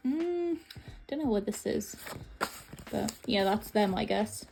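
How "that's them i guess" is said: ordinary delivery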